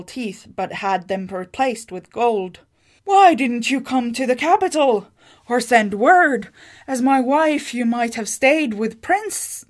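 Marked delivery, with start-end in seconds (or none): 3.0-9.6 exasperated questions. A narrator's reading. Husband demanding why she stayed.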